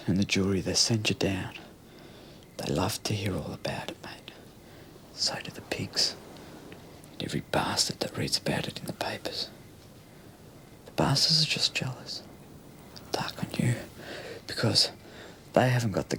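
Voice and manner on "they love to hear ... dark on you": whisper. Acted. Menacing confession to mate.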